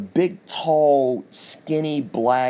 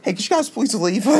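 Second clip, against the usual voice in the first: much higher, meek, overly polite ask; timid polite plea; 10 semitones higher than usual. Retold or imitated. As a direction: pretend to be firm but come out meek. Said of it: "Now I'm putting my foot down. It's more like".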